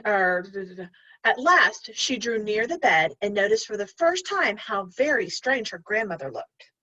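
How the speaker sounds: plain reading voice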